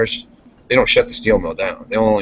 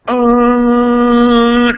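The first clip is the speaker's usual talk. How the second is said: long, high, loud horn drone; imitated horn blast; 12 semitones higher, 5 dB louder than usual. Worker showing the warning horn, imitated horn blast. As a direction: hold one high, steady note like a horn. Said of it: "you're supposed to blow the horn".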